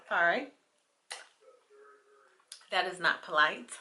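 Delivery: usual speaking voice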